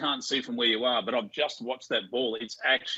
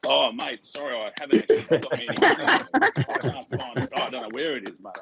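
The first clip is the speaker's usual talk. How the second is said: pub freeloader. Acted. A laughing host mimics a freeloader dodging the shout, sheepish. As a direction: fumble the excuse with a laugh, mate. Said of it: "They're the ones that go".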